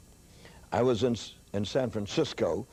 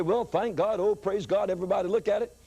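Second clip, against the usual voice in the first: mock fuss; 7 semitones higher than usual. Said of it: "He didn't turn around and say".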